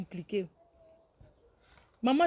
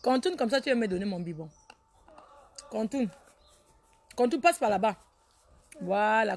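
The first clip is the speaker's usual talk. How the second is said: ordinary delivery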